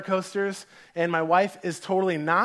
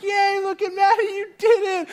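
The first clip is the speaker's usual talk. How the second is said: very high, loud imitation of a cheering crowd; hammed-up high-pitched hooray; 6 dB louder than usual. Onlookers greeting the girl after the ride, mock cheering. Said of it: "like, cheering".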